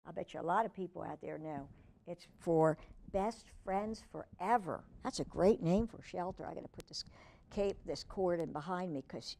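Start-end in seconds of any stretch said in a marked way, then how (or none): none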